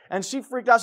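normal talking voice

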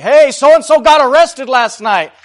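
high, loud, stretched-out yell across a crowd; hollering. Retold or imitated. Biker yelling news to someone in line, hollering. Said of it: "like, hollering to somebody in line".

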